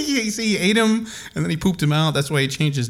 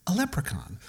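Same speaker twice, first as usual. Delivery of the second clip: quiet; 7 dB quieter than usual. Acted. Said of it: "the little girl said".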